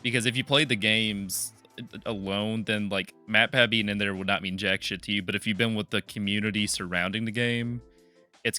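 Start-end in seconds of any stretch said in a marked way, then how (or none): none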